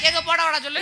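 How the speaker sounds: ordinary talk, nothing marked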